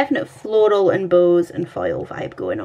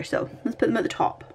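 whispered aside; 3 semitones higher, 7 dB quieter, faster than usual. Real, not acted. Quiet aside while placing stickers.

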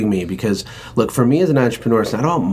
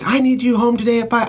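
much higher, mock-panicked demand; frantic wife; 13 semitones higher than usual. Imitating his wife's demand in a high mock panic. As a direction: play the freaked-out wife. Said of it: "my wife freaks and is like".

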